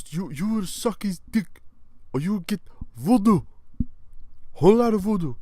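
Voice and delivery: loud, high put-on villain voice; threatening trafficker. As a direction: bellow voodoo threats in character.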